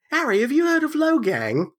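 put-on high nasal teen bro voice; Ron voice. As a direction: play Ron as a clueless influencer fan. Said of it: "I like your Ron voice".

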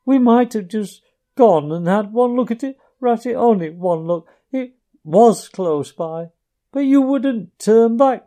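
high, loud, broken sobbing pleas; sobbing brokenly. A narrator's reading. Mole to Ratty, longing for his lost home, sobbing brokenly. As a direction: choke the words out between sobs. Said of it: "he sobbed forth at last brokenly".

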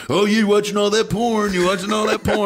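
high, loud jeering imitation; mock accusation. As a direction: mimic people jumping to accuse you. Acting out people's accusing reply.

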